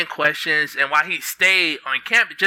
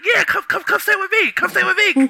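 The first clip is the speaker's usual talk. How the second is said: much higher, eager, gushing come come stay; overexcited invitation. Retold or imitated. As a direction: squeal the invite like a giddy host. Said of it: "'Cause I was so excited to meet him".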